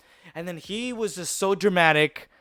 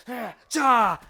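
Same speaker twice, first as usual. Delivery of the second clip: higher-pitched mock martial arts yelp; dramatic fighting cry; 6 semitones higher than usual. Kid copying Power Rangers before a fight, dramatic cry. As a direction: let out a hammed-up battle cry. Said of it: "I was real dramatic".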